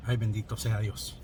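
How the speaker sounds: quiet pained prayer under breath; muttered prayer. Real, not acted. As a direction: breathe a little prayer through the burn. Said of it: "got me praying and shit".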